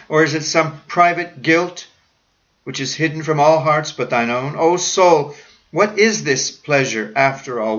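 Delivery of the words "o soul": ordinary talk, nothing marked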